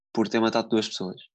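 ordinary delivery

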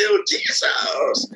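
long drawn-out, slow mock exclamation; imitating flippant misuse, vowels dragged out; joking exclamation. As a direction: stretch out the name as a joke. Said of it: "People are joking".

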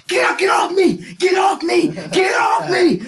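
high, loud get off me; struggling shout. Retold or imitated. Kevin pinned by teachers, shouting to be let go. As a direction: yell it while pinned by adults.

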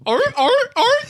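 three high, loud seal barks; seal bark imitation. Shown as a demonstration. Contestant answering what sound a seal makes, loud seal barks. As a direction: bark slowly like a seal, honking it out. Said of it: "what sound does a seal make".

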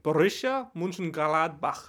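slow, deliberate German accent. Shown as a demonstration. Co-host showing how the club's name goes in a German accent. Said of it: "in a German accent or whatever".

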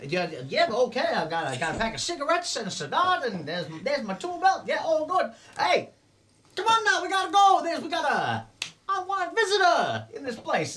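high, squeaky goblin talking fast to get away; a sly little goblin smoothing things over; nervous goblin. Acted. Goblin caught ransacking the house, squeaky nervous voice.

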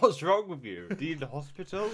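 high, loud mock concern; mock alarm. Friend joining a bit about hearing American accents, mock alarm. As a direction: act appalled, as if they need help.

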